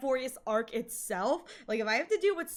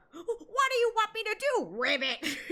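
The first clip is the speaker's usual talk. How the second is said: high mock-whiny character voice; imitated game villain; 10 semitones higher than usual. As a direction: squeal it, then drop onto the croak word. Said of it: "my favorite is the Fate collab".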